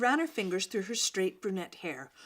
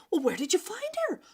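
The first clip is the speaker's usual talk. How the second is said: higher, fast eager question; eager girl's question; 10 semitones higher, faster than usual. Acted. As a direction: rush the question out, curious. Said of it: "a girl asked".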